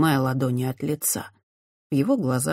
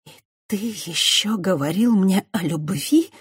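hissing; 4 semitones higher than usual. A narrator's reading. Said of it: "прошипела Христиана".

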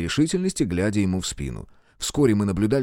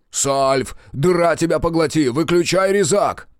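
higher, insistent acted yell; urgent shout; 6 semitones higher, 4 dB louder than usual. Crewman orders engineer to switch off cutter, urgent shout. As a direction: call out a frantic order. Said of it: "вдруг закричал Клаус".

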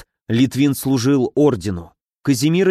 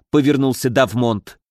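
usual speaking voice

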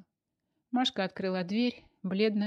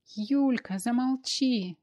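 higher, louder cry to stop her friend; desperate shout; 5 semitones higher, 5 dB louder, much slower than usual. A narrator's reading. Masha begs her friend Yulka to stay silent, shouting. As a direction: shout her down before she tells. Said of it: "закричала Машка так отчаянно".

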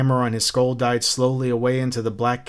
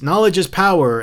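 higher, louder retold outcry; mock shriek; 7 semitones higher, 7 dB louder than usual. Captain imitating Montag in the dream, mock shriek. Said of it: "And you shrieked".

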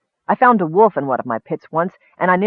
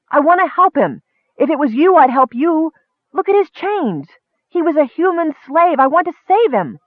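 impassioned plea; 11 semitones higher, 4 dB louder than usual. Acted. Kender pleads, enraged.

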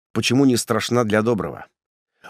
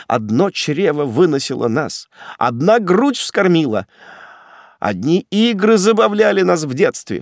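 rising, higher-pitched appeal; pleading; 5 semitones higher than usual. A narrator's reading. Prisoner invoking shared childhood with brother, rising plea. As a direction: build the plea step by step. Condemned man begs his brother.

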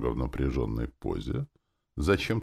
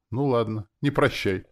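higher, mildly placating; conciliatory; 9 semitones higher, 4 dB louder than usual. A narrator's reading. Man humouring the demon, conciliatory. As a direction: give in to keep the peace. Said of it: "подумал я примирительно".

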